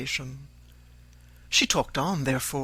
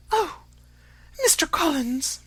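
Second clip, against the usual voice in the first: much higher, mournful sighing appeal; doleful; 14 semitones higher than usual. A narrator's reading. Mother appealing to the rejected suitor, high doleful lament. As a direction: wail it out sorrowfully to the suitor. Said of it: "In a doleful voice".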